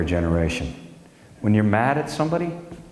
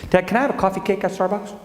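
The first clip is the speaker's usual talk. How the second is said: put-on higher child's plea; kid voice; 9 semitones higher, faster than usual. Son asking dad for coffee cake, higher. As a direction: wheedle like a young son. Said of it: "He goes".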